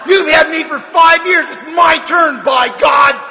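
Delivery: high, loud, drawn-out mock protest; indignant outburst. New sponsor's unspoken retort to Barney, indignant outburst. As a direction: burst out loudly, claiming your due.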